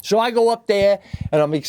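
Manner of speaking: ordinary delivery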